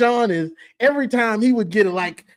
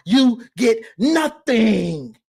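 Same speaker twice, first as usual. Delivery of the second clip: slow taunt; much slower than usual. Pretend. Taunting Ohio State.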